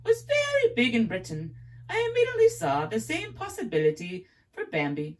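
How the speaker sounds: high, put-on British accent; English accent. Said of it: "my accent's horrible".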